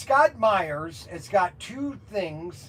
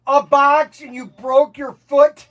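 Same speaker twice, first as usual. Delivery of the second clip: loud, high disbelieving yell at a friend; loud and incredulous; exasperated shout; 7 semitones higher, 9 dB louder than usual. Real, not acted. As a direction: shout in mock outrage at Mac. Exasperated at coworker Mac's injury.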